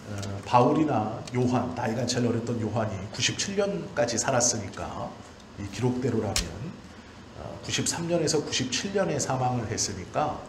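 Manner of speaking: ordinary delivery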